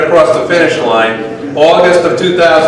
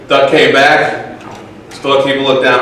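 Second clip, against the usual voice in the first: ordinary talk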